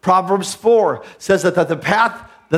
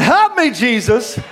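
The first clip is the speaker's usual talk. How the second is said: mock scream; 5 semitones higher, slower than usual. Preacher mimics people below their minimum, mock screaming. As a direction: imitate a desperate scream, higher and stretched. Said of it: "they start screaming".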